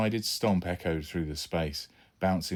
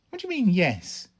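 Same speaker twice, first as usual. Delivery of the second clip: put-on boy's voice; 13 semitones higher, 6 dB louder than usual. Son confused by dad.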